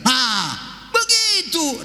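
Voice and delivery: light mock teacher, "begitu" rising high; a hint of a grudging nod; teacher's approval. Preacher imitating the teacher accepting his answer, mildly. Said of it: "apa dibilangnya".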